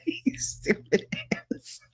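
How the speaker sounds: whispering. Real, not acted. Reading chat insult, whispered.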